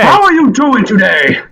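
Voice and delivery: high, hearty Russian creature voice; heavy Russian accent. Acted. Tower creature welcoming Sal, heavy Russian accent. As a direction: greet them in thick Russian-accented English. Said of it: "So a deep Russian voice".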